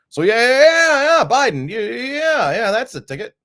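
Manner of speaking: high, loud, sarcastic yeah yeah; mock enthusiasm. Mocking a Biden win with loud sarcastic cheering. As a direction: cheer it on with open sarcasm, the pitch flying up.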